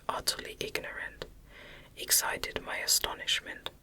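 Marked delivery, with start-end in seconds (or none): none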